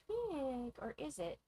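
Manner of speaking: ordinary delivery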